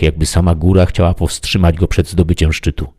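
ordinary delivery, nothing marked

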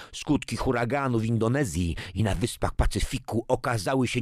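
high-pitched TV bulletin, rising in urgency; news report. Acted. Newsreader reports disasters worldwide, urgent broadcast style. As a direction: read like a newscaster building to an appeal.

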